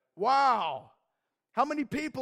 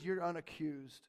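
soft reassurance; 6 semitones lower, 8 dB quieter than usual. Retold or imitated. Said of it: "when he says".

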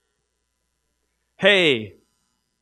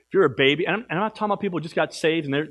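normal talking voice

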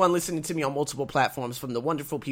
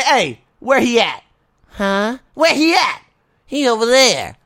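loud, higher, slow cocky call-out; mock swagger; 8 semitones higher, 9 dB louder, slower than usual. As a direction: strut in asking for the boss. Acting out dialogue at work.

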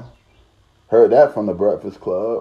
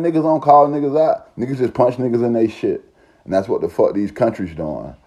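ordinary talk

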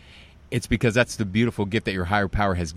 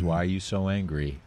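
soft, low counselor's question; retold counselor, hushed and even; calm repeat; 4 semitones lower, 9 dB quieter than usual. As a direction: repeat it quietly, unmoved. Counselor calmly repeats question, unmoved by denial.

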